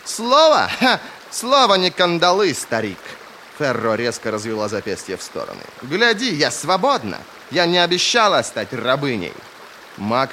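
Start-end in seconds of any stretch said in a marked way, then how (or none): 0.1-3.0 high, loud, indignant retort; scornful outburst. Acted. Heroine scornfully rejects a binding promise. 5.8-9.3 higher, louder, indignant protest; defiant outburst. Acted. Acted quarrel, heroine defies the mage.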